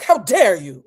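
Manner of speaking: loud, indignant mock outrage; angry. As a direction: scold with theatrical outrage. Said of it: "I was so angry".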